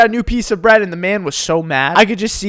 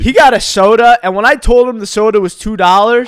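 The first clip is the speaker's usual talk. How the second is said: ordinary talk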